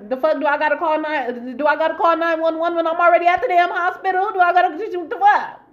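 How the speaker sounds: rising, exasperated sarcastic questions; acted tirade, high and loud by the end; indignant rant. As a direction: demand answers in outraged disbelief. Rants about calling emergency services.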